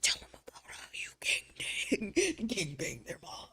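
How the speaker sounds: high, breathless demon whisper; a playful devil on the shoulder whispering; inner demon whisper. Pretend. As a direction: hiss it like a tempting inner demon. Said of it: "And Shade is like".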